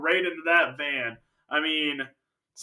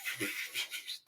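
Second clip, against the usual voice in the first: voiceless rushing whooshes; imitated zooming noise; 13 dB quieter than usual. Girl being zoomed round in circles, imitated whoosh. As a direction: make soft whooshing puffs for the spinning. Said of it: "and she just goes".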